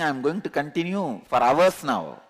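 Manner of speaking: ordinary delivery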